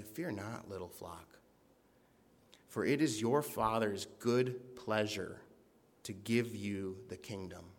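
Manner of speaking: hushed, reassuring words from a father; gentle tender reassurance. Shown as a demonstration. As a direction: comfort them like a loving father. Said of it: "you just need Jesus to tell you".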